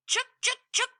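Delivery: high chattering squirrel scold; sharp high squirrel chuks. Acted. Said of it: "clattered in the high branches".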